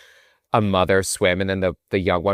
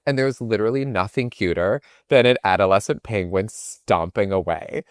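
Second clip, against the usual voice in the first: ordinary talk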